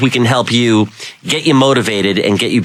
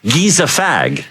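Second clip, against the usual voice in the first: put-on European accent; slower than usual. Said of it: "a lot of people will say".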